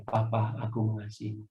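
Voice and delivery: soft, faltering, barely voiced words of love; haltingly. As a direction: force out each word, choking. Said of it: "Dengan terbata-bata saya bilang".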